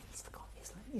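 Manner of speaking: breathy, hushed voicing of a friend's worried aside; whispered aside. Friend at the National whispers to the guest, hushed whisper. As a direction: whisper it as if nudging a friend in a theatre seat.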